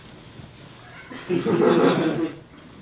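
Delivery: nervous laugh. Acted. Nervous disbelief at threat.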